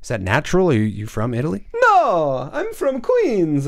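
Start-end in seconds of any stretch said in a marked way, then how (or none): 1.8-3.7 put-on squeaky high Italian accent voice; high-pitched Italian dad voice. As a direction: play a goofy high-voiced Italian father. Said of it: "your high-pitched voice".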